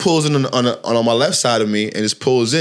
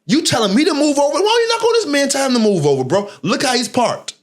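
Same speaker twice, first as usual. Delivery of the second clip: retold angry yelling; 12 semitones higher than usual. Banging on a stranger's car door, yelling at him heatedly. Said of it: "I bang on homie door".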